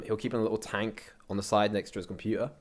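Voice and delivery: ordinary delivery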